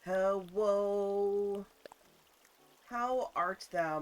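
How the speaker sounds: loud, slow playful olde English; hammed-up grand welcome; mock formal greeting. Mock old-fashioned greeting.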